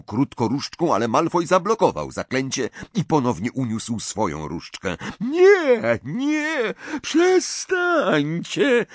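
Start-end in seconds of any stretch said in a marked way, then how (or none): 5.2-8.9 very high, slow, shrill-sounding wail; ghost calling out. A narrator's reading. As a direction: cry out high and long like a ghost. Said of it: "zawołała jęcząca Marta".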